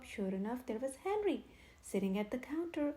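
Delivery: ordinary delivery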